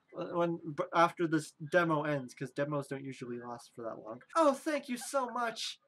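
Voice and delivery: ordinary talk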